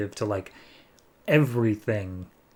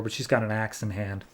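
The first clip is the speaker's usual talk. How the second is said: usual speaking voice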